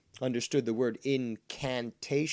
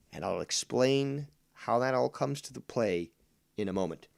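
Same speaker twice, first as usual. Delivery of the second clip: normal talking voice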